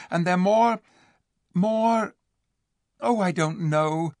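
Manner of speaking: rising, searching for words. A narrator's reading. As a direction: reach for a word you can't find. Lucy lost for words.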